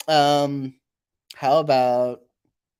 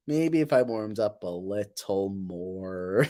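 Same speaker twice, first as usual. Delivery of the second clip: slow, lower, stretched words; silly drawn-out voice; 5 semitones lower, slower than usual. Pretend. Streamer joking about warming up the voice, slow silly drawl. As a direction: drag each word out playfully.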